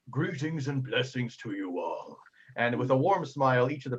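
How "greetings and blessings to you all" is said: slow, soft, kindly blessing; a lightly voiced gentle elder; benevolent priest. Priest blessing the tavern, slow and serene.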